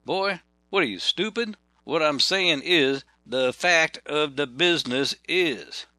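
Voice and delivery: high, slow Southern scolding, the fact of the business is; slow Georgia drawl. Retold or imitated. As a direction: drawl it out like a baffled old boy.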